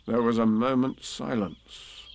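ordinary delivery

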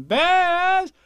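high, loud, slow taunting call. A narrator's reading. Child calls to bears in the street, high drawn-out taunt. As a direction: sing it out boastfully, very high. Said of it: "and I say to them".

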